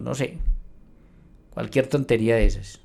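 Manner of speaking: usual speaking voice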